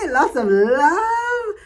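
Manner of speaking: high, slow, sung-out "Lots of love"; fond, drawn-out goodbye; singsong farewell. Real, not acted. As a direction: croon it to the audience. Warm sign-off to listeners.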